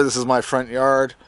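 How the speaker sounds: usual speaking voice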